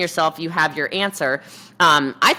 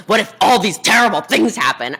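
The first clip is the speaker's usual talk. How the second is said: hammed-up worry, louder; mock alarm; 3 semitones higher, 5 dB louder than usual. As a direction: mimic a fretting worrier. Mocks worriers' bad-advice objection.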